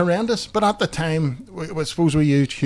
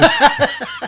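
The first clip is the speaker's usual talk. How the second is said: short high laugh; real laugh breaking into the punchline; squeak of laughter; 4 dB louder than usual. Real, not acted.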